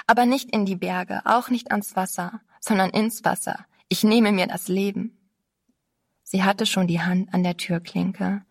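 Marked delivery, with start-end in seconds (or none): none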